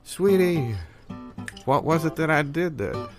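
normal talking voice

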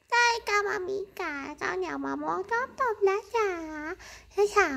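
high, cutesy pet voice answering for the cat; cat's voice. Pretend. Owner voices her cat answering.

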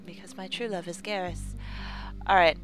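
ordinary delivery

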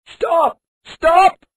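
put-on old couple yelling stop down the lane. A narrator's reading. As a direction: bellow after the runaway. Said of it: "they yelled".

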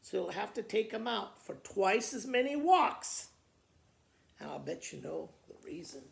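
usual speaking voice

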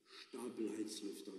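softly repeated, miles away; dreamy repeat. Acted. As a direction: murmur it back, lost in a daydream. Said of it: "wiederholte er versonnen".

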